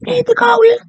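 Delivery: high, loud mock scream. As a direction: shriek it like a patient losing it. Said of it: "you're gonna be screaming".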